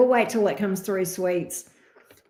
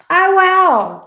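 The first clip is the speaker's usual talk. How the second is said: surprised wow; 10 semitones higher, 9 dB louder than usual. Real, not acted. Reacts to viewer's location.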